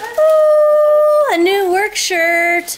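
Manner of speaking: high, slow delighted ooh. Real, not acted. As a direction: savour the surprise. Mock delight at shirt.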